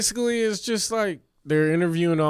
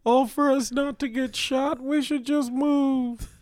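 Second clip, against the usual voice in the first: scared mom; 10 semitones higher than usual. Pretend.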